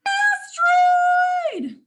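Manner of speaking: hammed-up storybook shout of danger; dramatic alarm. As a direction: sound the alarm to wow the class. Dramatic alarm at asteroid page.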